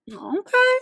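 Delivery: high, rising mock surprise; a startled, hammed-up okay; taken aback. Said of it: "I'd be like".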